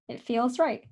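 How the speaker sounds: hiccuping between words; talking through hiccups. Real, not acted. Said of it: "I'm getting the hiccups".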